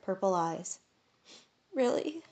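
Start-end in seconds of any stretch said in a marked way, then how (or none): 1.7-2.2 high hopeful question. A narrator's reading. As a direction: grasp at a sliver of hope.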